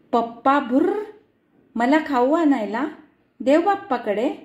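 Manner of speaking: put-on innocent toddler. Toddler says papa flew away.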